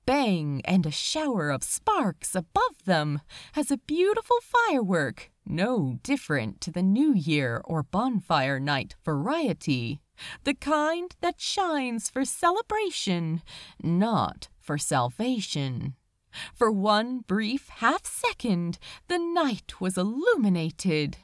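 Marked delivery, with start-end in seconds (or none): none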